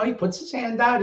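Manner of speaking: ordinary talk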